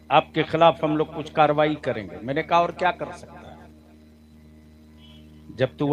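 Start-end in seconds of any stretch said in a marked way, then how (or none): none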